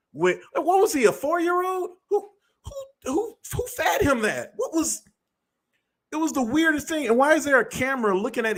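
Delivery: can't believe what he's watching; incredulous. Real, not acted. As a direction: voice jumps high in disbelief. Incredulous rant about the video.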